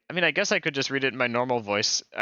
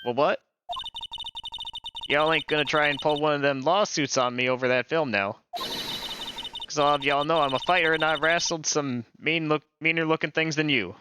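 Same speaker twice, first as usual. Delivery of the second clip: put-on Southern accent. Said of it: "I'm not the best at doing accents".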